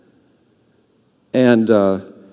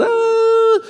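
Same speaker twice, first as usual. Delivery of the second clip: mock cry. Fervent praying climax.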